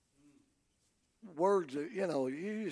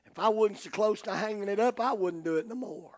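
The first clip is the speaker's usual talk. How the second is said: ordinary talk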